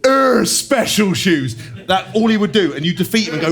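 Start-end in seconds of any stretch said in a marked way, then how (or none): 0.1-1.6 drawn-out, higher, louder mock drone; mocking teammate drone. Teammate mocking new trainers in a slow, higher drone. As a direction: drag out "special shoes" like a dim taunt. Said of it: "you just hear this".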